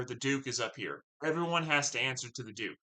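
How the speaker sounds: ordinary delivery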